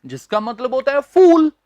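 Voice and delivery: ordinary talk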